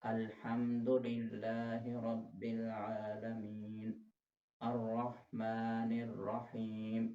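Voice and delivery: अल्हम्दुलिल्लाहि and रहीम slow, ह from the throat; slow recitation with throaty ha. Shown as a demonstration. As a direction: recite slowly, stress the throat ह. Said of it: "ये दोनों हा हलक से अदा होंगे". (धीमी तिलावत, हलक से ह)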